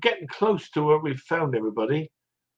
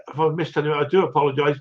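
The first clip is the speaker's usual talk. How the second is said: usual speaking voice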